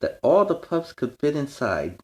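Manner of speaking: bright, storybook read-aloud; reading to children. A narrator's reading. Reading a picture book aloud to young fans. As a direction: read it like bedtime to little kids.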